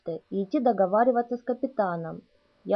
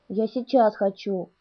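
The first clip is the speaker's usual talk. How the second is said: ordinary delivery, nothing marked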